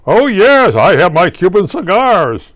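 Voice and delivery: high, loud, smug mock radio host; Rush Limbaugh. Mocking the radio host's cigar habit, smug and loud. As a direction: ham up a proud cigar lover. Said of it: "his time with cigar".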